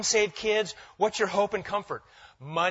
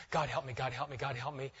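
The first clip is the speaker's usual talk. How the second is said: low, soft anxious repetition; muttered mock prayer; 4 semitones lower, 8 dB quieter than usual. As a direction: mutter a desperate little prayer under the breath. Acts nervous silent prayer during service.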